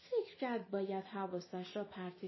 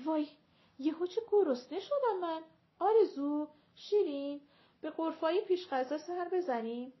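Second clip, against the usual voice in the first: high, louder outburst of sudden hunger; exclamação animada; 8 semitones higher, 5 dB louder than usual. A narrator's reading. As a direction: call them along in a raised, lively voice. ماه منیر interrupts, calling the women along.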